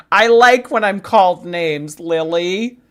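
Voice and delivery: high, loud, hammed-up confession; playful loud declaration. Streamer jokingly reassuring a chatter who apologized, loud and playful. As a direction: belt it out as a joke.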